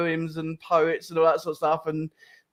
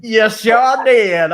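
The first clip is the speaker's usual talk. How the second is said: very slow, louder American drawl, mocking the guest; 6 semitones higher, 9 dB louder, much slower than usual. Host teasingly echoes the guest's fake American, in a very slow, loud drawl. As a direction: stretch it out in a hammed-up American accent. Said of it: "it's not washing with me tonight".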